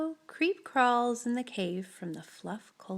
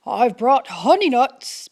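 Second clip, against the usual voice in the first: louder badger voice with a grin; grinning badger; 10 dB louder than usual. Badger grins while offering honey nuts to friends. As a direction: offer the treat gleefully. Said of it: "Badger says with a grin".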